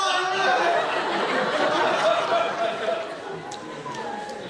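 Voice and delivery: long high laugh. Real, not acted. Speaker laughing at the happy ending, high and long. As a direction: laugh with delight.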